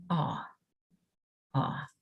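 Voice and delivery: soft, quiet "aw" said alone; short o sound. Shown as a demonstration. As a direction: model the short "aw" sound gently. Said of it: "says the short O sound, which is".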